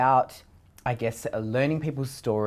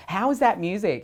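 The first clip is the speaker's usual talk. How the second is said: mock puzzled producers; 10 semitones higher, 6 dB louder than usual. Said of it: "And they're like".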